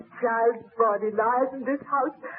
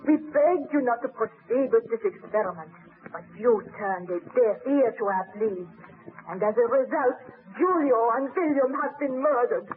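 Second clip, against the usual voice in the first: normal talking voice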